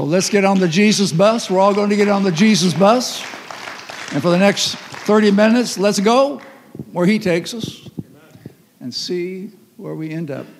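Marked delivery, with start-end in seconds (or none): 0.0-6.4 loud, emphatic preaching call; rallying call. Real, not acted. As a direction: fire up the congregation. Preacher rallies the congregation.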